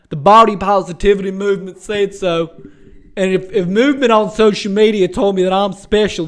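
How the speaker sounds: loud, put-on voice of a defensive incel; hammed-up whiny excuses; mocking incel voice. Mocking an online commenter, put-on defensive whine. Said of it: "incel on his computer being like".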